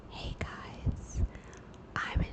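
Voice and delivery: breathy whisper into the mic; barely whisper, like hiding in a library. Shown as a demonstration. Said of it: "I was literally talking like this in the mic".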